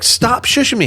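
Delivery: high-pitched retold protest; annoyed. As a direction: push back at being hushed, acted out. Retold quarrel over shushing.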